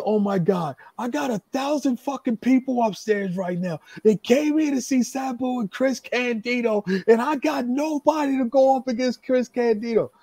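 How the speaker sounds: high-pitched, exasperated lament; frantic despair. Retold or imitated. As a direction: imitate a promoter in a panic. Promoter panicking over a missing opponent.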